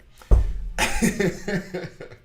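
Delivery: real burst of laughter, high and loud; loud breathy laugh. Real, not acted. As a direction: let the rant break into a big laugh. Bursts out laughing after rant.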